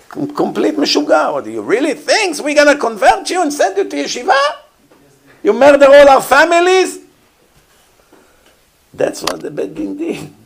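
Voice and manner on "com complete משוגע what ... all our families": much higher, fast incredulous scolding, ending louder; imitating an appalled rabbi who can't believe it; outraged rabbi. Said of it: "What would the rabbi say?".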